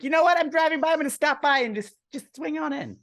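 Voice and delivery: very high, loud caricature of a woman; high-pitched mocking character voice; put-on customer voice. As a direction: play the drop-in customer in a squeaky high voice. Co-host acts out the customer dropping by.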